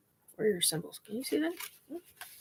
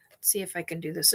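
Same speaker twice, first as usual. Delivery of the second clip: quick whisper to herself; 4 semitones lower, 6 dB quieter, much faster than usual. Real, not acted. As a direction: whisper it fast under breath. Concentrating on the cards.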